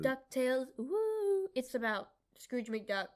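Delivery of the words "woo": woo. Real, not acted. Cheering the show title.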